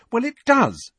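high-pitched, impatient insistence; defensive, high and emphatic retort. A narrator's reading. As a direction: snap it back, pitched right up. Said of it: "she said impatiently".

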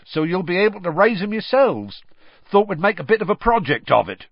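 loud, high, eager pride; boastful enthusiasm, voice lifted; proud. A narrator's reading. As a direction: proudly pitch the plan to the class. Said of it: "said Hagrid proudly".

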